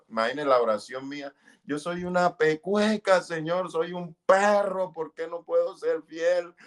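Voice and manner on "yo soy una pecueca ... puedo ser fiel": high, pleading self-reproach; retold anguished pleading; desperate prayer. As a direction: cry out to God in despair. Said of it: "gritaba desesperado".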